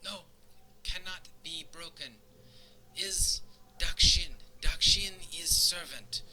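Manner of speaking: high, halting voice of a distraught servant; slow, pleading, pitched high in broken speech; tearful broken Common. Acted. Crying servant refuses to accept his broken collar, tearful broken Common. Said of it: "You can see the tears streaming down his face".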